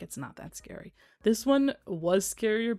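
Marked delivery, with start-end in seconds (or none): 0.0-0.9 unimpressed. Retold or imitated. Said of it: "you're like".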